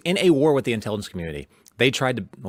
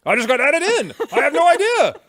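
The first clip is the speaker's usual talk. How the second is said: high-pitched feigned surprise, hammed-up; mock-innocent journalist; 12 semitones higher, 4 dB louder than usual. Host mocking an opposition journalist's lucky strike, mock-innocent. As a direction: play clueless in a much higher, mocking voice. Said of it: "where he's like".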